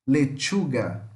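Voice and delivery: slow "Lechuga" with a T before the sh; careful model of "Lechuga", crisp tch. Shown as a demonstration. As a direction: stretch "Lechuga" as le-TCHU-ga. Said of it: "pusesse um T aqui na frente".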